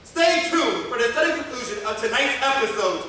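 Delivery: booming slow title announcement; radio announcer. Acted. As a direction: announce it like an old radio serial.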